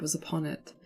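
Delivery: ordinary delivery, nothing marked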